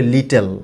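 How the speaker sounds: "লিটল" with a full "tel" second syllable; mispronounced লিটল. Shown as a demonstration. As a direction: say "লিটল" as lee-tel, both syllables fully voiced. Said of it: "but এটা লিটল হবে না". ("লিটল"-কে ভুল করে লি-টেল বলা)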